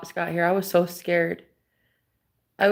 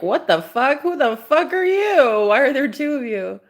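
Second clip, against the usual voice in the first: sudden high-pitched shocked disbelief; startled swearing, pitch jumping up; shocked outburst; 5 semitones higher than usual. Retold or imitated. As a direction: react like a stranger just walked into your house. Stranger appears around the corner.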